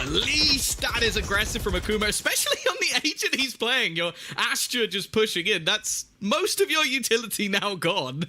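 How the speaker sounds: high-pitched esports commentary; live play-by-play. Real, not acted.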